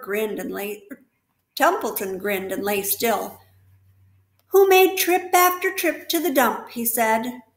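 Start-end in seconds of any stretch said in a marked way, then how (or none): 4.5-6.7 higher and louder indignation; the rat lists his grievances; indignant. Acted. The rat complains loudly.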